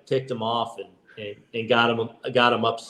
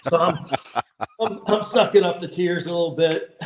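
laughing through the words, higher; laughing while telling; 7 semitones higher than usual. Real, not acted. Telling his brothers about the fight, laughing. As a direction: chuckle as you recall holding back tears.

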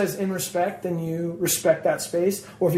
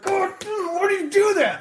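high, shouted "why do you do that"; hammed-up indignation, much higher; mock outraged yell; 14 semitones higher than usual. Singer mocking the guy who threw piss, high shout.

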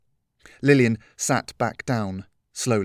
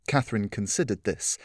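plain reading voice

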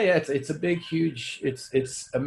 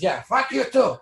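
raised, higher retort into a phone; mock angry; 8 semitones higher than usual. Pretending to curse a friend on earpiece, angry. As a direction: fire back a hammed-up insult.

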